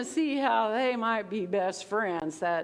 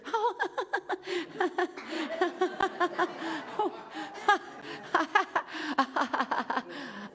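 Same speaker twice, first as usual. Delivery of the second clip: silly laugh; 7 semitones higher than usual. Shown as a demonstration. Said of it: "I can get kinda silly".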